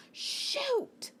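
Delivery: high breathy whispered "Shoot!"; whispered panic. Retold or imitated. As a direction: gasp it out in sudden panic. Realising she lacks medicine.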